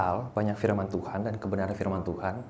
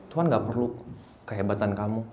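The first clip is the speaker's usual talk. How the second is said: normal talking voice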